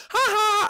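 high, mocking "ha ha"; gloating laugh. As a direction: crow triumphantly at a rival. Mario gloats over cool ones.

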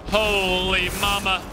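ordinary delivery

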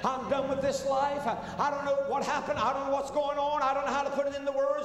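high, loud preaching climax; preaching at full force. Acted. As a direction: lift the whole testimony into a loud, high preacher's cry. Preacher builds testimony to climax.

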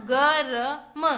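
गरम stretched slowly as ग-र-म; syllable by syllable. Shown as a demonstration. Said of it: "इधर से हम पढ़ेंगे तो क्या बना?".

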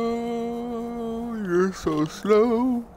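high, very slow drawn-out whine; whiny complaint. Acted. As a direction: drag it out, mock impatience. Mocking slow master.

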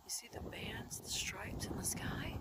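unvoiced whisper. Real, not acted. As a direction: whisper it like a secret. Whispering over sky footage.

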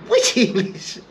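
high, laughing mock question; laughing disbelief. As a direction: ask it through a laugh, baffled. Own baffled reply, acted exchange.

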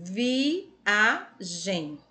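viagem split into three slow, separate syllables. Shown as a demonstration. Teacher separating viagem for pupils, syllable by syllable. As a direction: sound out viagem as vi, a, gem. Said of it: "pronunciou com mais intensidade".

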